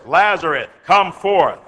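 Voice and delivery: calling out loudly, voice ringing; loud cry. Acted. Jesus calling Lazarus from the grave, loud cry. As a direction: summon a dead man out of his tomb. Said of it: "he cried with a loud voice".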